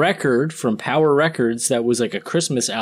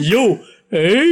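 short, higher calls as a lightly voiced alien; Alf voice; 5 semitones higher, 4 dB louder than usual. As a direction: try a quick hint of Alf. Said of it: "Is that the elf voice?".

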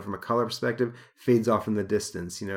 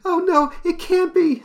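very high, slow falsetto wail; distressed woman; 8 dB louder than usual. Acted. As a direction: shriek it like a fainting lady. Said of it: "says Lorna".

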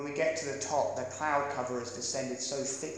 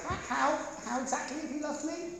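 much higher, whiny demand for evidence; mocking girlfriend; 12 semitones higher, 5 dB quieter than usual. Young man to his girlfriend, a high mocking demand. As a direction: mimic a petty challenge, high and pinched. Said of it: "Don't turn round and say".

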